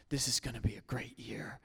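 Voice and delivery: whispered to yourself; awed whisper; hushed awe. Retold or imitated. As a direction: breathe it in wonder at the sunrise. Hopeful kid at sunrise.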